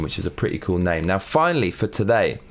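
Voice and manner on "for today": normal talking voice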